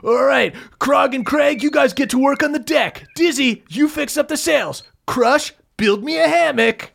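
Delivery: Funky barking jobs at the lizard crew; bossy foreman. Acted. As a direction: hand out jobs like a boss. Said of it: "Funky goes".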